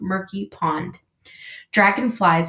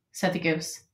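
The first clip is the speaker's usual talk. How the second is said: usual speaking voice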